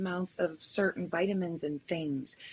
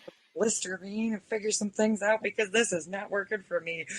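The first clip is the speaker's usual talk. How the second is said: mock panic; 12 dB louder than usual. Acting out tasting oil by mistake.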